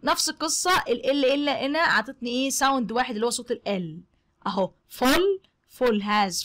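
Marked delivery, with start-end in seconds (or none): none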